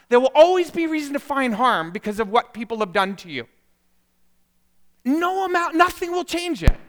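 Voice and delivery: high, loud, urgent sermon delivery; impassioned preaching. Real, not acted. Preacher urging congregation to forgive, impassioned full force. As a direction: preach at full force, driving each point home.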